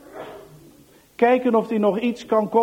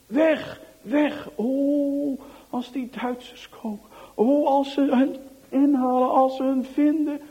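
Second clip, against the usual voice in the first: fearful; 6 semitones higher than usual. A narrator's reading. Fleeing, fearing capture.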